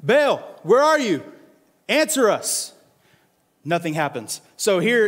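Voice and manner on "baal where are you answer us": calling out. Acted. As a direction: shout for an absent god. Prophets calling to Baal.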